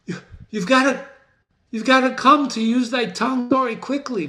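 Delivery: high, hurried and pressing; urgent Macbeth. Acted. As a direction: demand the news at once. Teacher voicing urgent Macbeth.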